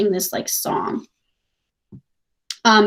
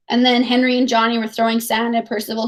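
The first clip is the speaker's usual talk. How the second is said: plain reading voice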